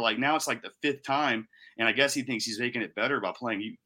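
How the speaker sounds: ordinary delivery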